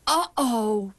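ordinary delivery

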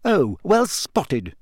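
slow, high, biting sarcasm; Hermione, acid. A narrator's reading. Hermione to Ron who just noticed she's a girl, acidly. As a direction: stretch it out with acid scorn. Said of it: "she said acidly".

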